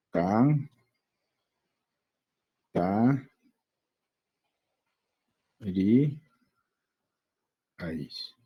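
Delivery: "can, ta, ri, ais" as held syllables with long pauses. Shown as a demonstration. Teacher working out the answer with students, "can, ta, ri, ais" one syllable at a time. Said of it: "¿cómo sería esto de acá?".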